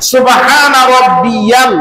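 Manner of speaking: সুবহান রাব্বিয়াল drawn out slowly, each sound careful; slow recitation. Shown as a demonstration. Preacher showing the congregation the correct tasbih, slow and careful. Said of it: "কি বলছি?".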